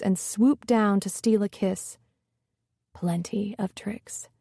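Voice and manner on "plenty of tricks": said softly. A narrator's reading. Trey teasing her in bed, softly. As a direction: murmur it close and soft. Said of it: "he said softly".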